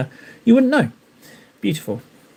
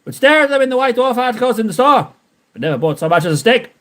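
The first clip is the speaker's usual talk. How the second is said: hammed-up Frankie voice; 4 semitones higher than usual. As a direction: read it out as Frankie would. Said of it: "I've got to speak it like Frankie".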